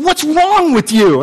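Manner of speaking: high, slow accusing challenge; accusing brother. Acted. Grieving brother confronts Saul, high and slow. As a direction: demand an answer from him.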